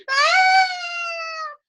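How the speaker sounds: very high, loud imitated toddler shriek; toddler's scream. Three-year-old climbing up mom's side, imitated shriek. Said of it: "the three-year-old then starts to scream".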